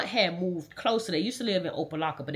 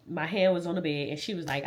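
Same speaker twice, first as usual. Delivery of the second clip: usual speaking voice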